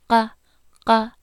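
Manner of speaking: uvular qa from the back of the throat; Hmong qa. Shown as a demonstration. Teacher modelling qa far back for students. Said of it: "here I always say the Q looks like an egg".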